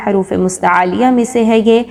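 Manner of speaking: usual speaking voice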